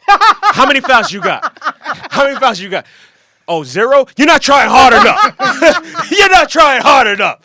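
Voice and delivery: high, loud hectoring yell; mock halftime rant. Teammate mimicking the halftime speech to everybody, mock berating yell. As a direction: berate the whole team, hammed up. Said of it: "his halftime, uh, speech to everybody".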